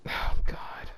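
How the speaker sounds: breathless, voiceless whisper of dismay; a real cringing whisper; whispered oh god. Real, not acted. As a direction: barely breathe it out, mortified. Exhausted after reading fanfic.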